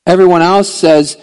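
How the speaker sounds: loud, driving sermon voice; preaching at full force. Acted. Pastor preaches Easter hope to the church, loud.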